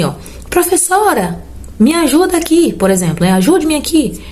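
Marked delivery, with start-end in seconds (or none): 0.5-2.7 high-pitched imitation of a pupil's appeal; calling the teacher. Pupil asking the teacher for help as a vocative example, high calling voice. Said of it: "Quando eu falo assim". 3.3-4.2 high-pitched acted plea for help; calling the teacher. Teacher rewording the pupil's example request, high calling voice. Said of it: "Quando eu falo assim".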